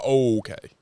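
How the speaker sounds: deadpan reaction. Retold or imitated. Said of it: "I'd be like".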